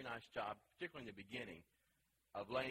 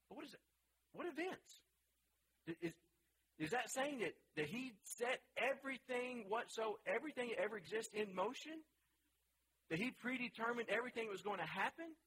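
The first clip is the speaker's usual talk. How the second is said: incredulous questioning; 9 semitones higher than usual. Real, not acted. Teacher pressing challenging questions.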